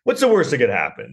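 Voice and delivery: lightly voiced executive going along with it; agreeable movie executives. Executives answering a silly movie pitch, mock-agreeable. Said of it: "And they're like".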